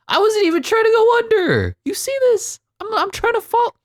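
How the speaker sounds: squeaky-high indignant protest; very high-pitched, worked-up complaint; voice going high. Real, not acted. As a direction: protest way up at the top of your range. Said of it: "my voice is going that high".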